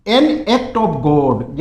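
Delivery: very slow, careful and loud; slow dictation; slow model phrase. Shown as a demonstration. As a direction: lay out each word. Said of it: "फिर और देखिए".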